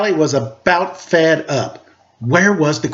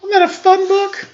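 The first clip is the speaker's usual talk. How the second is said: cheerful reader. Real, not acted. Reader asking children after the story, very high cheerful question. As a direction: sell the fun to the little listeners. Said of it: "reader addressing children".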